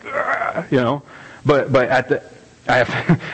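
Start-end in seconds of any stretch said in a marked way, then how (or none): 0.0-0.6 high breathless ugh of exasperation; frustrated groan. Retold or imitated. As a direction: let out a voiceless exasperated ugh. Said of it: "and I'm like".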